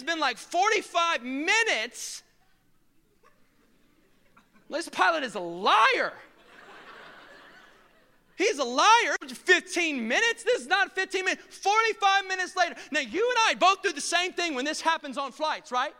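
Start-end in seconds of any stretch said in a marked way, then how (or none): 4.7-9.2 indignant. Retold or imitated. Frustrated passenger on delayed flight. 9.3-10.4 higher, slow outraged question; mock-disbelieving echo of the pilot; incredulous. Pilot promised fifteen minutes. 10.4-12.7 indignant. Retold or imitated. Frustrated passenger on delayed flight.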